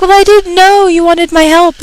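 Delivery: whiny imitation of the coworker; imitating coworker. Voicing CSM mockingly.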